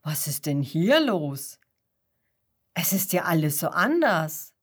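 a lightly voiced old shepherd in wonder; astonished shepherd. A narrator's reading. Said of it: "ruft er erstaunt". (tieferer, erstaunter Hirte)

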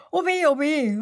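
laut und hoch klagend; übertrieben jammern wie ein Kasperlepüppchen; laut jammernd. A narrator's reading. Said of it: "ruft der Seppel laut jammernd". (Seppel jammert laut mit dem Kopf in den Händen)

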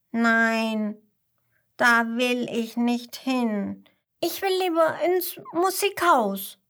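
insistent youngster, pitch rising into a plea; defiant little koala. Acted. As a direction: refuse like a stubborn child, stretching the words. Little koala refuses, wants music house.

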